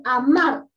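"amar" with a strong push on the last syllable, a-MAR; stress on MAR. Shown as a demonstration. Teacher showing children vocal force, "amar" stressed on the final syllable. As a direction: lean hard on "mar" in "amar". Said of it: "donde tiene mayor fuerza de voz es mar".

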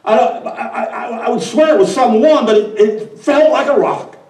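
nervous underling babbling to the boss; flustered wind. Acted. The wind excuses itself to the devil, flustered and stammering.

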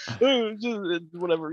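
dim, dopey shrug of a line; idiot voice. Acted. To Elvin, a lightly voiced idiot brushing it off. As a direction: play him as a dull idiot. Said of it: "He said like an idiot".

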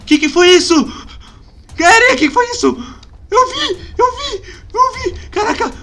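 loud, high panicked screams; terrified screaming. Real, not acted. Gamer screaming after a monster appears. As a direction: scream in real terror.

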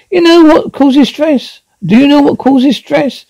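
high-pitched, exasperated questioning; exasperated wife. Acted. Exasperated wife confronts her husband.

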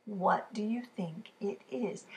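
slow whispered question. Real, not acted. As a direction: hush and ask slowly.

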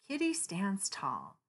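plain reading voice

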